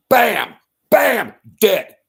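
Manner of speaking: high, loud shouted bangs; loud high shouted bam bam, then dead; shouted impact bangs. Retold or imitated. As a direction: yell the hits like blows landing. David's sling strike acted out.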